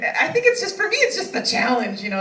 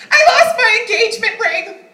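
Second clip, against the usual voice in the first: high, loud dramatic mom voice; mom's headline; 12 semitones higher, 9 dB louder than usual. Retold or imitated. Mother calling her daughter about the ring, high loud blurt. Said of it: "she goes".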